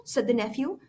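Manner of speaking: ordinary delivery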